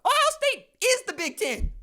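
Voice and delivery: high, mocking emphatic voice; mock insistence. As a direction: lay it on thick, like it's obvious. Mocking Ohio State's status.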